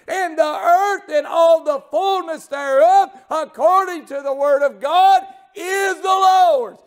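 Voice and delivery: high, slow, laughing then tearful shout; preaching at full force. Acted. Preacher declaring the earth is the Lord's, tearful shouting climax.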